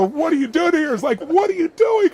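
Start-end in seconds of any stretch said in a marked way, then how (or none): 0.0-0.9 high, loud exasperated cry; exasperated outburst. Acted. Husband to haggling girlfriend at the counter, exasperated, high and loud. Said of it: "wanted to grab her by the throat". 1.2-2.1 very high, loud protest; hammed-up throttling exasperation; exasperated outburst. Embarrassed boyfriend to his bargaining girlfriend, exasperated, very high and loud. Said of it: "wanted to grab her by the throat".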